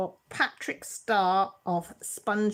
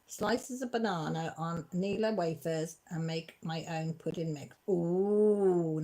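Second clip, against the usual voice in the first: usual speaking voice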